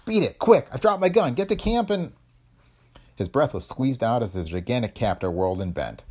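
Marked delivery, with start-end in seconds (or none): 0.1-2.1 high, urgent orders to flee; pitched-up frantic warning; desperate call. A narrator's reading. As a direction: send her running, desperately. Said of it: "he called desperately".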